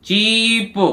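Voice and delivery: "चीप" with a drawn-out ee; long ee sound. Shown as a demonstration. Teacher giving an EA example, "चीप" with long ee. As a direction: hold the ee in "चीप". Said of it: "ई ए से बड़ी की मात्रा".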